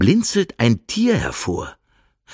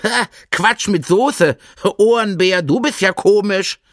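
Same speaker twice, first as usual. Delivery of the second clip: mocking hare; 10 semitones higher, 3 dB louder than usual. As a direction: scoff at the idea in a high hare voice. Hare mocks the bear.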